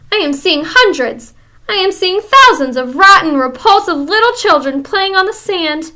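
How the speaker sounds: high, loud, foreign-sounding tirade of disgust; ranting witch. Acted. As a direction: rage at the revolting sight. Witch ranting about children.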